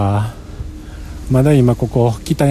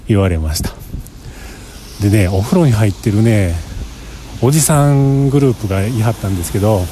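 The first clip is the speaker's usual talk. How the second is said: usual speaking voice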